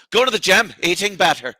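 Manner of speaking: mock Irish-sounding accent slip; Jim Norton. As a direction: mimic his accidental fake accent. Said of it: "he said it just like that".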